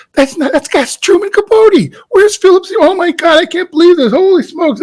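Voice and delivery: high, excited rush of disbelief; starstruck disbelief. Retold or imitated. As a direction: squeal inwardly that it's really him. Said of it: "I'm thinking all this".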